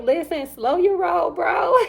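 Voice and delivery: a retold teasing rebuke to the eager dad; sassy pushback. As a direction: rein him in with playful sass. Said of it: "I'm like".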